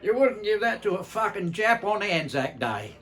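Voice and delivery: put-on gruff old digger. Uncle Tommy spitting out the local beer, loud disgusted jibe. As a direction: spit the line out like a cranky veteran. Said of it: "spat it out and said".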